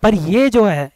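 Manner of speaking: ordinary talk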